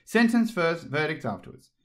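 high queen laying down law; imperious. A narrator's reading. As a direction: decree it haughtily. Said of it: "said the queen".

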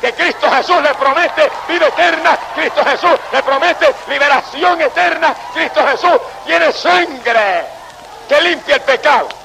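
fervent preaching at the crowd, pitch up; a Spanish preacher calling Rome's faithful to flee to Christ, fervent preaching; full-force preaching. Acted. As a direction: preach it hard and urgent.

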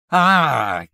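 loud, high cry of terror; startled shout. A narrator's reading. Man as shadow leaps on bed, shouting. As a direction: yell out in sudden fright. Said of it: "came the shout".

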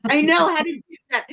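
high, loud, astonished outburst; retold amazement. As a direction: blurt it out as a stunned realization. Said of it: "I was like".

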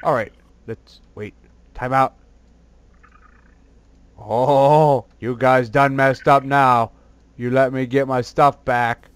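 low, slow plotting villain voice; deep scheming villain. Acted. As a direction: growl out the plan like a cartoon baddie. Deep voice threatening enemies.

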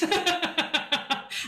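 high, rattling puppet laugh; string of quick high ha-has; Marvin. Acted. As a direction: giggle like an excited little boy. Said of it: "And he laughs like that".

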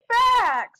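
loud, high sudden shout. Real, not acted. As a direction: bark it out. Sudden exclamation.

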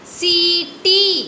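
सी टी with both long ई drawn out, slow; teacher modelling सी टी slowly; stretched reading. Shown as a demonstration. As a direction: stretch each long vowel in सी टी. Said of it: "क्या हुआ?".

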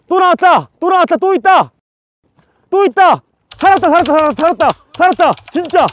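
excited. Real, not acted. Finding live clams on the shore.

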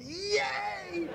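very high delighted "Yay!"; cheering. Retold or imitated. General cheering the pilot's answer, high whoop. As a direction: burst out in a cheer. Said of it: "And I went".

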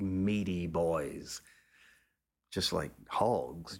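ordinary delivery

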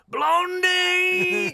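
huge high bellowed call; a full-throated manager's yell; shout. Retold or imitated. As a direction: bellow the nickname down the corridor. Manager calling player from door.

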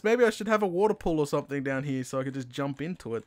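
ordinary delivery

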